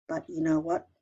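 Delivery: usual speaking voice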